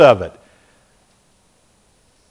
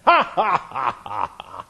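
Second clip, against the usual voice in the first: scornful tyrant's ha-ha-ha, fading away; mocking laugh; 4 semitones lower, 7 dB quieter than usual. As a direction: laugh down at them like a gloating king. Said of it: "You can almost hear him laughing".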